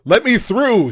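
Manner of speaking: confident shout, high and loud. Acted. Will shouts at crowd to make way, confident shout. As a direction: push through with command. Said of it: "he shouts".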